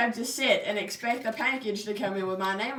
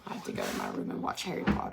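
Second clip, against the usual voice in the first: much lower put-on deep voice; faster than usual. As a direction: drop into a deep voice and rush it. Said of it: "deep voice".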